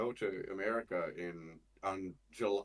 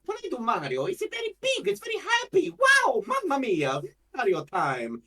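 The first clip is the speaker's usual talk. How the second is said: very high, loud, big and happy game hero; Mario; 9 dB louder than usual. Shown as a demonstration. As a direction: exclaim it huge and joyful in mock Italian. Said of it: "as when I voice Mario".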